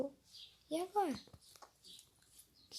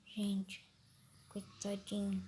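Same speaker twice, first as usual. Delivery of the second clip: ordinary talk, nothing marked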